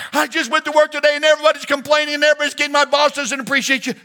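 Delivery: high, loud, rushed wife's rant; complaining wife. Acted. Pastor voicing a wife venting to her husband, high and loud. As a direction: pour out the wife's grievances, high and fast.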